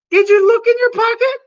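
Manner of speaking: wife's voice. Acted. Wife voiced in skit.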